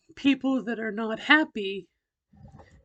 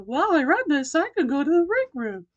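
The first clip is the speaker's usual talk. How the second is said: higher, louder, lifted voice; corrected practiced voice; 6 semitones higher, 7 dB louder than usual. Retold or imitated. Speaker redoing a muttered line in the practiced voice, high and loud. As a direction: repeat it pitched up and projected. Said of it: "I repeated the same exact thing".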